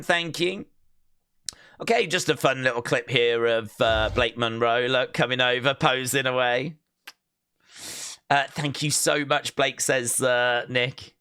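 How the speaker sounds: usual speaking voice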